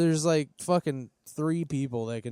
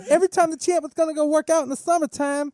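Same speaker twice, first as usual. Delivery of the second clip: high lisping Mike Tyson impression; squeaky, lispy boxer voice; 9 semitones higher, 4 dB louder than usual. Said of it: "I don't really sound like him".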